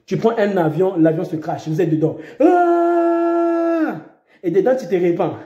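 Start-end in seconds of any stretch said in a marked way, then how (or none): 2.4-4.0 high pretend cry of terror; a short staged scream; mock crash scream. Preacher acting a crash victim's scream.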